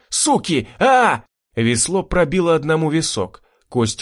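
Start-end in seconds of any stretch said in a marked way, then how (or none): 0.1-1.2 high, loud frantic scream; hysterical yelling. A narrator's reading. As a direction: shriek in panic and fury. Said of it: "истерично орал он". (истеричный вопль)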